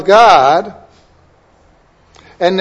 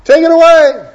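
high, pleading cry rising to a louder "away"; crying out to God; 5 semitones higher, 3 dB louder than usual. Retold or imitated. Paul begging the Lord, raised pleading cry. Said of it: "He cried out to the Lord".

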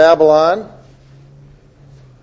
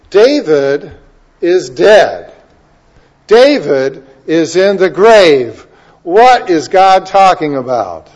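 preaching emphasis; 3 semitones higher, 8 dB louder, slower than usual. Acted. Preaching point.

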